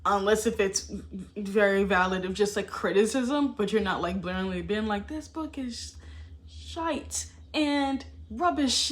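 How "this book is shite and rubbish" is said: mock British accent. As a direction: sneer it like a British critic, hammed-up British accent. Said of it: "I'm using the U K language".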